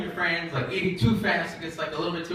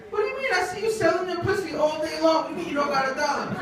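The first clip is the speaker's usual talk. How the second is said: high, quick street-argument voice; indignant disbelief; 11 semitones higher than usual. Acted. Man on the corner disputes the lady's claim, high indignant voice. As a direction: argue back in high-pitched mock outrage.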